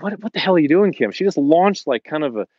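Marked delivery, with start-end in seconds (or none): 0.0-1.1 louder, higher, imitating a snapped rebuke; mock yell at her sister, loud and sharp; retold angry yell. Scolding sister at family gathering.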